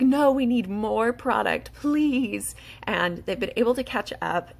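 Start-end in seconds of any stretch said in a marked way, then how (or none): 0.0-2.5 higher, louder, drawn-out mock plea; begging. Distributors begging the company for more product, pleading. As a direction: plead for more, half in jest. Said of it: "we were literally begging".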